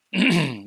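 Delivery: loud throat clearing. Real, not acted. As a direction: clear your throat sharply. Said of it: "Excuse me for clearing my throat".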